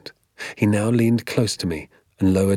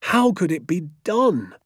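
cried out; 9 semitones higher than usual. Acted. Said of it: "he cried".

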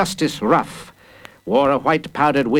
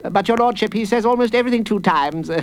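high, eager prosecutor's voice; 11 semitones higher, faster than usual. A narrator's reading. Prosecutor explaining the boy's habit to the judge, insistent. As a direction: protest deferentially, pitched high. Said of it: "said the prosecutor".

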